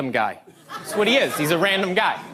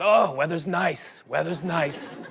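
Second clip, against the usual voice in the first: evasive bluff; 4 semitones lower, 5 dB quieter, slower than usual. Acted. Bluffing an answer about weather.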